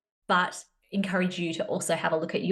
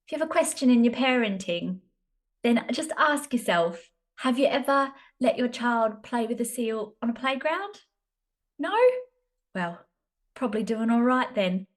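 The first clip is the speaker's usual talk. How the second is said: put-on higher-pitched, amateur English accent; 4 semitones higher than usual. Podcaster imitating a lady advising on parenting, English accent. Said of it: "says it in an English accent".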